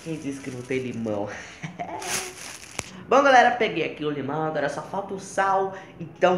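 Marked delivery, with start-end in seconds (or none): 1.3-3.0 breathy laugh. Real, not acted. Breathy laugh after joke.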